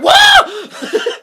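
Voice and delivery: loud high laughing whoa; startled whoa. Retold or imitated. Reacting to the suicide jump, loud laughing whoa. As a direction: yelp it out in shocked delight. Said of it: "And I went".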